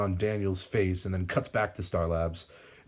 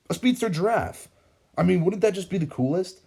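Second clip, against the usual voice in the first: much higher, louder excited voice; eager; 11 semitones higher, 5 dB louder than usual. A narrator's reading. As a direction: gush like a kid with a new idea. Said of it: "Ralph says eagerly".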